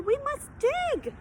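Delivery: high, slow, urgent plea; a boy urging his father to dig. Acted. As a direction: insist they dig now. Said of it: "he shouted".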